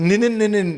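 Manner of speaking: garbled repeated "ninin" syllables; mumbled nonsense n-sounds; tongue-tied stumble. Shown as a demonstration. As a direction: trip over the n's: "ninin, ninin". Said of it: "we struggle to say".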